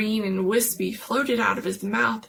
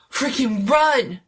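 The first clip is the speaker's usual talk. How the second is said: loud, higher yell to flee; urgent shout; 5 semitones higher, 7 dB louder than usual. Acted. Jones yelling at friends to run, urgent. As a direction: shout the warning.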